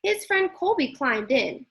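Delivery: ordinary delivery, nothing marked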